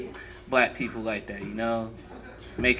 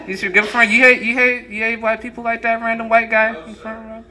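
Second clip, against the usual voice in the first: louder, higher, laughing needling; teasing crowd member; 9 semitones higher, 7 dB louder than usual. Real, not acted. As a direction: goad the audience guy, grinning.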